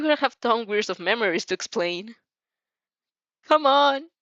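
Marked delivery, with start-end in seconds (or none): 3.5-4.1 loud, high, teasing urging; playful exasperation; snorting impatience. A narrator's reading. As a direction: chide them, pitched up. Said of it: "she snorts you".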